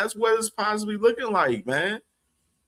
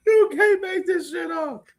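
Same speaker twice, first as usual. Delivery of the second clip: much higher, half-shouted through laughter; laughing shout; 10 semitones higher than usual. Real, not acted. Streamer repeating the rumor line to chat, laughing shout. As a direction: blurt it out, cracking up.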